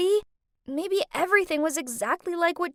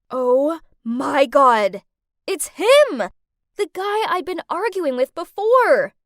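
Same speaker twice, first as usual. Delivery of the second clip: shocked realization; 7 dB louder than usual. Acted. Recognizes her captor.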